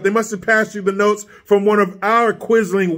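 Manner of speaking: usual speaking voice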